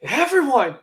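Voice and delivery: high-pitched coaxing offer; tempting promise; coaxing Eddie. Retold or imitated. Eddie bribing Venom.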